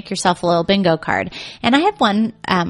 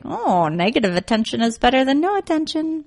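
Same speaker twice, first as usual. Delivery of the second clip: put-on baby's inner voice; 6 semitones higher than usual. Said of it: "they're like".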